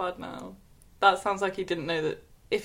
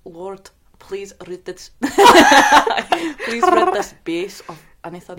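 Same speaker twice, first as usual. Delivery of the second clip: slow, lightly put-on Scottish prayer, drawn out; a half-hearted Scottish priest, dragging each word; Fresh Meat Scottish; 11 dB louder, slower than usual. Shown as a demonstration. Friend mocks the story's prayer in a rough Scottish accent. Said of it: "That's the only Scottish I can do".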